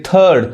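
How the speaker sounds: थर्ड as "thuhd", no r; silent r. Shown as a demonstration. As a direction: drop the r in थर्ड. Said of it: "थर्ड नहीं".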